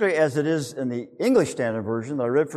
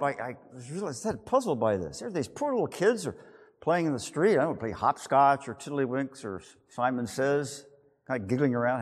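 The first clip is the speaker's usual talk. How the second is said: ordinary talk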